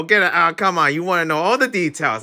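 ordinary delivery

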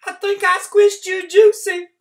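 put-on old country woman. Trotter voice.

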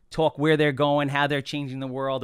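ordinary delivery

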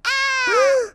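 short, high, loud scream; storybook scream. A narrator's reading. Girl reading Goldilocks waking to three bears, acted scream. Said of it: "screamed Goldilocks".

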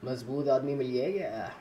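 normal talking voice

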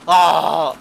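very loud yell; frustrated scream. Real, not acted. Oyunda sinirlenip bağırıyor. (sinirli çığlık)

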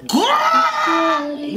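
shrieked exclamation; high shout. Real, not acted. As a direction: squeal it out high. Exclaiming at lyric.

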